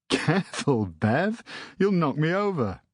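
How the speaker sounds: a hint of a cheery older brother; jovial uncle. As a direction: protest playfully while being hugged. Uncle greeted by hug.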